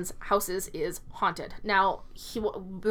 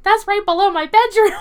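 much higher and louder, half-laughing alarm; alarmed realization; 12 semitones higher, 12 dB louder than usual. Retold or imitated. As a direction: blurt it out in mock panic. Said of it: "He's like".

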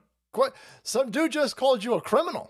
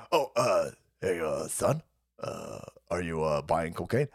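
put-on much lower, halting, mumbled old dad; mock old dad; 3 dB quieter than usual. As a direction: play a confused old father. Voicing Biden as dad.

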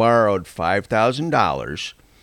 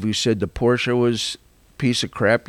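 usual speaking voice

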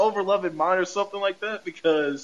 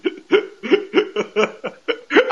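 long high-pitched laughing fit; real laughter; 10 semitones higher than usual. Real, not acted. Podcaster cracking up as the episode recap ends, laughing. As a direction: keep laughing helplessly, high.

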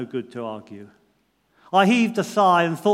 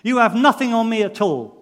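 loud angry outburst, pitched much higher; angry shout; 7 semitones higher, 9 dB louder than usual. A narrator's reading. Defendant shouts furiously at the judge in the dock. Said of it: "I shouted".

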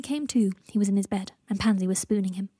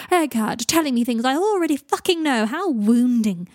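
loud, high, theatrical sarcasm; mock martyrdom; 4 semitones higher, 7 dB louder than usual. A narrator's reading. Draco mimes being stabbed by Greg's insult, melodramatically sarcastic. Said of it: "rolling his eyes to the sky dramatically like a martyred saint".

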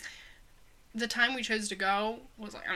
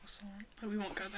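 whispering; 11 dB quieter than usual. Real, not acted. Whispered correction aside.

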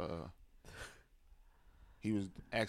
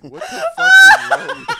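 very high, loud laughing yell; incredulous shout; 29 dB louder than usual. Retold or imitated. Storyteller replaying outburst before hanging up, incredulous shout.

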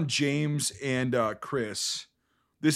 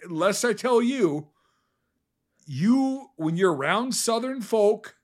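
mock Southern accent; 6 semitones higher, slower than usual. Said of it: "you have a fucking Southern accent".

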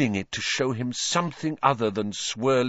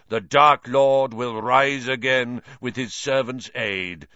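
harsh trance voice; 3 dB louder than usual. Acted. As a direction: intone it like a possessed oracle. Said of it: "in the same harsh voice".

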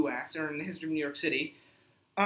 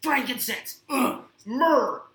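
higher, louder strained grunts; grunting crunches; 4 semitones higher, 5 dB louder, much slower than usual. Pretend. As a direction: grunt each word mid sit-up. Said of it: "He's like".

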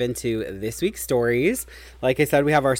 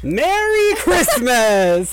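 slow, high, stretched festive greeting; drawn-out jolly Santa greeting; 10 semitones higher, 4 dB louder, much slower than usual. Pretend. Playing Santa for the camera.

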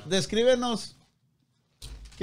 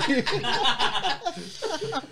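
high laugh; 9 semitones higher than usual. Real, not acted. Reacting to the burn.

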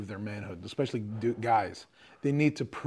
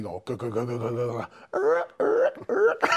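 rapid vocal guitar chugs rising into a high squeal; imitated guitar noise; much slower than usual. Describing guitar therapy to a friend, imitated guitar noises. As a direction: mouth out chugging guitar noises.

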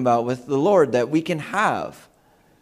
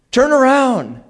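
high, louder acted warning; urgent warning; 10 semitones higher, 5 dB louder, slower than usual. Father trying to save his daughter, urgent. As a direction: call it out high to save her.

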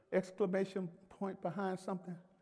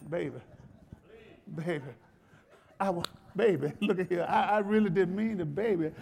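mock pleading; 2 semitones higher, 4 dB louder than usual. Husband who messed up begs his wife, mock pleading. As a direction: beg like a sorry husband, hammed up. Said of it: "there's a way to really plead".

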